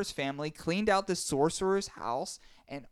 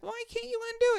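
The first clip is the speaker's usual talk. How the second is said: put-on baby voice; much faster than usual. Grandpa whines in high voice.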